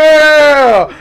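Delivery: a loud, high whoop of delight; cheering yell. Retold or imitated. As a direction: cheer like you're hyped. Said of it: "I was like".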